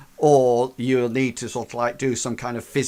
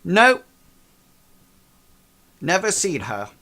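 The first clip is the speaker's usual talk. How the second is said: curt denial; 7 semitones higher, 6 dB louder than usual. Acted. Magician curtly denies knowing her.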